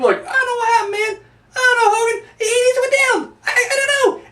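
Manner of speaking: hammed-up high whimpering excuse; Jimmy Hart voice. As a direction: play a panicking little weasel pleading ignorance. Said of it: "Jimmy Hart's like".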